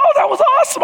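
high, loud delighted cheer. Retold or imitated. Friend praising the skier's wipeout, high excited call. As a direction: praise the crash to lift him up.

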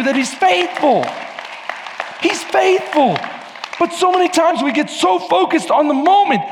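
high, loud, impassioned preaching; full-force preaching. Real, not acted. Preacher telling the congregation God is faithful, preaching full force. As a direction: drive it home to the whole congregation.

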